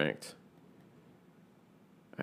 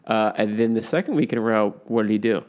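ordinary talk

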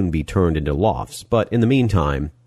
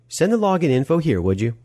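brisk Molly; 10 semitones higher than usual. Acted. Molly's voice in narrated story.

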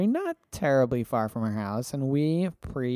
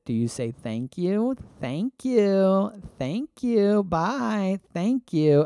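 put-on high sing-song baby talk; 8 semitones higher, much slower than usual. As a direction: coax a toddler to say thank you. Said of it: "a baby voice".

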